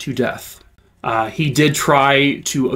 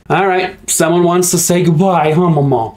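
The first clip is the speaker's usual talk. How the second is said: higher, louder coaxing voice to the pet; pet talk; 4 semitones higher, 5 dB louder than usual. Real, not acted. Owner asking pet Mama to say goodbye, coaxing. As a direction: coax the pet sweetly.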